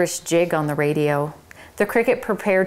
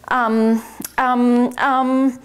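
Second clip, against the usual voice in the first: flustered ums; 6 semitones higher, 3 dB louder, much slower than usual. Acted. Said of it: "Tucker Mouse was very much flustered".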